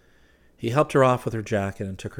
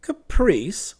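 high, loud incredulous echo; indignant cry; 12 semitones higher, 8 dB louder than usual. A narrator's reading. Clifford retorts to her charge of caprice, crying out. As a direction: throw the word back loudly. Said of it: "he cried".

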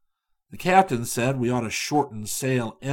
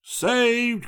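higher, relieved exclamation; joyful cry; 12 semitones higher, 4 dB louder than usual. A narrator's reading. Captain cries out as raft reaches shore, higher and glad. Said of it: "cried the captain".

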